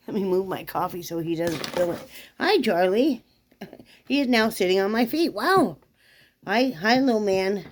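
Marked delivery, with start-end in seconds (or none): 2.4-7.6 high pet talk. Real, not acted. Greeting the dog.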